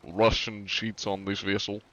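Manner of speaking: hammed-up KGB interrogator with Russian accent. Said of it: "This sounds like big sweaty Russian man".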